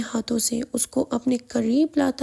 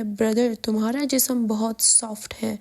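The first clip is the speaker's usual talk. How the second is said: ordinary delivery, nothing marked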